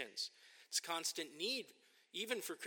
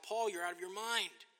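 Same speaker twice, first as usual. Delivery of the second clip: louder, higher cry at Paul; cries out; 6 semitones higher, 6 dB louder than usual. Retold or imitated. Festus crying out at Paul, raised voice. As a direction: throw the accusation out at Paul. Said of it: "Festus cries out".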